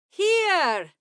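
high, loud hail to the group; ringing high call. A narrator's reading. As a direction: call it out loudly from a distance. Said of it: "Salamander called out".

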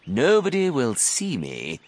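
high, slow, sly crocodile; enormous crocodile. A narrator's reading. Crocodile assuring his doubter, slow and smug. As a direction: stretch it out smugly. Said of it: "he said".

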